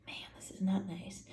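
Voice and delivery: soft unvoiced tut; hushed dismay; whispered aside. Real, not acted. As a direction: whisper the disapproval. Aside reading Pharaoh's insult.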